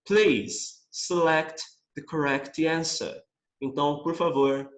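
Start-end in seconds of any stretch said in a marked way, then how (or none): 0.1-3.2 slow, careful English reading; slow read-aloud. Shown as a demonstration. Teacher reading the sentence slowly. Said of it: "Vou ler pra vocês".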